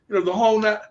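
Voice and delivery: ordinary talk, nothing marked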